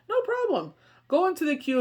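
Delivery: high, biting sarcasm, the pitch shoots up; sarcastic no problem. Retold or imitated. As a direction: toss it off with mock cheer.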